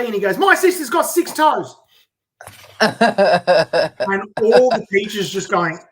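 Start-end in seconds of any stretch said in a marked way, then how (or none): 0.4-1.8 put-on excited kid voice. Kid answering in retold show.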